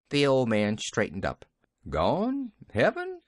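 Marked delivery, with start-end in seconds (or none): 1.9-3.2 dazed high disbelief; a dazed old gardener repeating her words; stupefied. A narrator's reading. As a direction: echo it back, baffled and rising high. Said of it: "he repeated stupidly".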